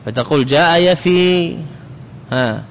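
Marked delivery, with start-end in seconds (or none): 0.5-1.6 يفي ending in a drawn-out long ī; final long ي. Shown as a demonstration. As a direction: stretch the final ي of يفي, slowly. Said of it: "بالياء".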